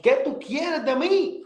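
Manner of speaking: high, pleading outcry, drawn out; retold desperate cry. On the floor of the room, begging God, desperate. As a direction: cry it up to heaven in despair. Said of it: "gritando, desesperando".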